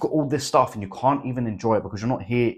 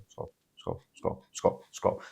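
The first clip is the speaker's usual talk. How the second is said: muttered scroll scroll; 3 semitones lower, 9 dB quieter, slower than usual. Retold or imitated. Mimicking couples scrolling phones.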